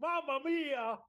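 high, hammed-up Italian accent. Super criminal to the policewoman, hammy Italian accent. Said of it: "I mean, the accent is something else".

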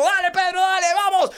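high, loud egging-on yell; urgent, high-pitched cheering at a young son. Acted. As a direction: shout encouragement like an over-invested dad.

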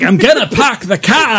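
hammed-up Boston, car as kah; bad Boston accent. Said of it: "We gotta talk about that accent".

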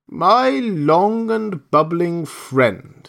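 slow, high, mock-sweet greeting; a genie drawling false friendliness. Djinn addresses camel.

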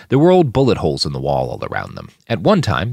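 ordinary delivery, nothing marked

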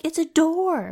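high, loud mock exasperation. Imitating a friend pointing out the door, high exasperated voice. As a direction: say it like it's obvious, pitched high.

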